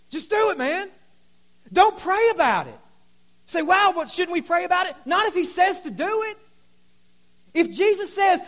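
loud, high, fired-up preacher; full-force preaching. Real, not acted. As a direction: drive it home to the congregation.

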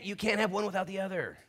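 high, loud, impassioned pulpit delivery; sustained high-pitched sermon shout; preaching at full force. Real, not acted. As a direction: preach it out at the top of your voice.

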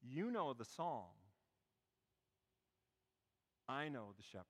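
soft, low, measured reply; young man's quiet answer. Acted. Young man answering the humbled actor, quiet and measured. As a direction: say it gently, with a long pause midway. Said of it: "the young man looks at the actor and he goes".

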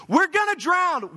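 high, slow, frantic mock panic; hammed-up cry of sailors sure they'll sink; panicked disciples. Disciples woken in the storm, mock panic. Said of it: "they're clearly in a panic".

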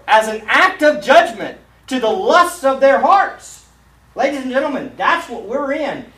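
high, loud pulpit proclamation; fiery preaching. Acted. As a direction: drive the judgment home to the congregation. Preacher at full force.

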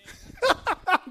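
high burst of laughter; laughing. Real, not acted. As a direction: laugh out mid-sermon. Laughs amid the preaching.

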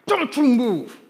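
loud, high stern teacher's command. Retold or imitated. Teacher stopping two runaway pupils, stern loud command. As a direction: order kids to halt like an angry teacher. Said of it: "she used that teacher voice".

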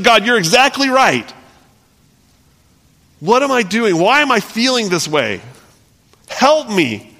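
high, earnest plea to God; pleading prayer. Pretend. Imagined prayer of repentance.

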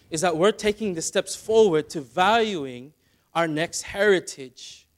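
impassioned pulpit voice; preaching at full force. Acted. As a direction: press the call home to the congregation. Preaching warning to parents.

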